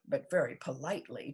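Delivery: ordinary delivery, nothing marked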